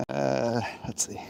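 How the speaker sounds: ordinary delivery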